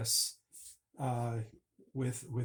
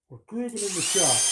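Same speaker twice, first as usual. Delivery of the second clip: excited shout; 7 semitones higher, 11 dB louder than usual. Real, not acted. Excited shout over shop machine noise.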